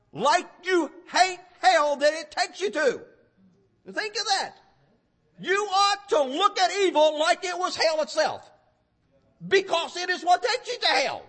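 emphatic sermon delivery, key words punched; forceful preaching. Real, not acted. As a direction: preach it hard in driving phrases.

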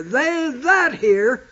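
slow with "that" held; stress on THAT. Shown as a demonstration. Preacher stressing the key word to the congregation. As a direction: weigh the word "that". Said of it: "that word "that" took on some importance".

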